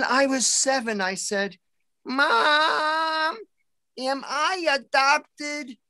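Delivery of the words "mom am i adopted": put-on high, slow little-kid plea; child voice. Seven-year-old asking his mom if he's adopted, high and small. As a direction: ask it like a small worried boy.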